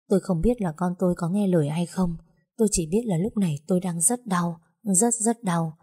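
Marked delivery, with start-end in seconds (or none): none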